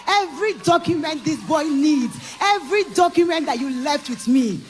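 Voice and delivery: father's offer. Retold or imitated. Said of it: "his father reached out to me and said".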